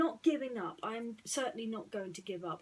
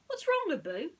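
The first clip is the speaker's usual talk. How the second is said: put-on high, caring young hero voice; Aladdin, concerned; 10 semitones higher, 9 dB louder than usual. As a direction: call out gently to the monkey. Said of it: "asked Aladdin".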